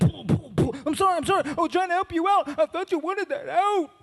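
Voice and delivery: put-on high, sheepish apologetic kid voice; pleading nephew. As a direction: grovel with a high, squeaky apology.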